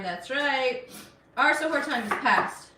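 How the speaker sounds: usual speaking voice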